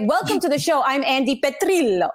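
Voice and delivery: hammed-up host introduction with Italian flourish; mock show host. Mock on-air intro, Italian name.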